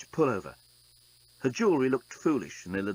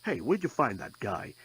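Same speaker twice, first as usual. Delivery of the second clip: usual speaking voice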